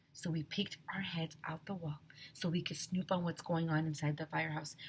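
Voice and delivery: soft, whispered telling; whispered narration. A narrator's reading. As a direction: hush the story as they sneak.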